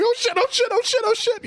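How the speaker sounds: very high-pitched mock panic squeal; hammed-up high scream, not full volume; imitated screaming. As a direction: squeal it over and over like a panicking movie character. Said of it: "like, screaming".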